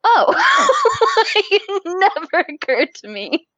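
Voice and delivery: high laugh into a slow, amazed admission; slow, laughing dawning realization. Real, not acted. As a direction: laugh at never having thought of it.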